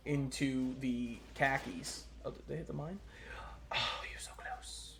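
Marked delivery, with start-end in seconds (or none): 2.2-4.9 tense whisper at the screen; hushed, willing them on. Real, not acted. Watching a near miss.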